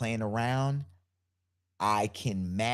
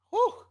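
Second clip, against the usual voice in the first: high, loud sigh of relief; mock relief, pitched way up; relieved whew; 5 dB louder than usual. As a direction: blow out a squeaky whew of relief. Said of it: "Short-term focus is".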